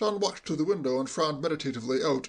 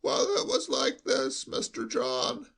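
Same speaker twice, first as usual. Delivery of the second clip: high, slow, quavering sheep-like bleat; an old servant bleating every word; bleating old butler; 10 semitones higher, slower than usual. Acted. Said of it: "bleated like a sheep".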